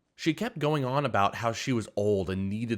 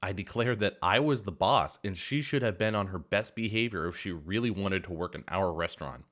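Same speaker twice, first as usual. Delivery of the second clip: plain reading voice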